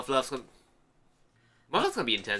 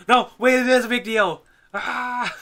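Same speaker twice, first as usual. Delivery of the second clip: much higher, loud hammed-up cry; mock outraged outburst; 12 semitones higher, 9 dB louder, slower than usual. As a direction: blurt it out as a melodramatic character. Mock outburst voicing character.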